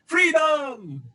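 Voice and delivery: high battle-cry yell of freedom; Braveheart shout. Retold or imitated. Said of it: "I shouted".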